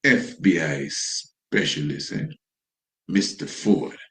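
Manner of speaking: low, slow, sarcastic drawl; mocking. Commentator sarcastically repeating the FBI agent's title, low and slow. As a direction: sneer at the title, slowing right down.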